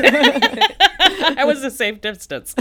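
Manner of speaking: laughing the words out slowly; slow, laugh-filled confession. Real, not acted. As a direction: chuckle through it, amused at the trick. Joking about fooling the viewers.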